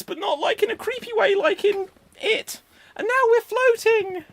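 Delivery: very high, squeaky, fast voice; squeaky old man voice. Acted. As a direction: squeal it like a cartoon oldie. Acts out Up in a minute.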